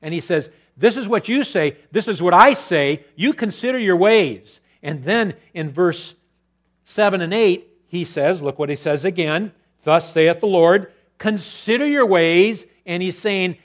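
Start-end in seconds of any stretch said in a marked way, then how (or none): 0.8-4.6 loud, higher stern rebuke; God's rebuke. Acted. 9.8-12.7 loud, higher proclamation. Acted. Proclaiming God's word.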